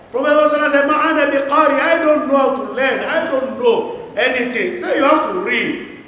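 normal talking voice

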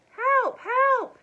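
very high, loud cries; screamed for help. A narrator's reading. Elmer fakes distress to lure the men, screaming. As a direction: scream out as if in danger. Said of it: "he screamed in a gruff voice".

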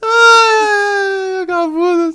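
high whiny cry of outrage; mock outraged cry. Real, not acted. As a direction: wail like you were betrayed.

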